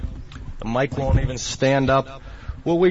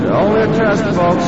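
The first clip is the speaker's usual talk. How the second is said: higher, louder put-on test announcer; eerie calm announcer; 7 semitones higher, 6 dB louder than usual. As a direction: boom it out like a warning broadcast. Mock emergency broadcast voice.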